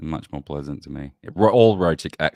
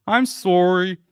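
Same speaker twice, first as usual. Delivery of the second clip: high, loud mock "sorry"; mocking echo; 12 semitones higher, 5 dB louder, slower than usual. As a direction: parrot the apology, pitched way up. Mocking how others say sorry.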